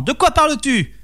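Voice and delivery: storybook peasant losing patience; getting annoyed. A narrator's reading. Peasant demanding the serpent's price, irritated voice. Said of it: "fit Yves en s'énervant".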